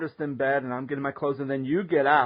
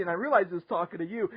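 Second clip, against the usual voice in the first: ordinary talk, nothing marked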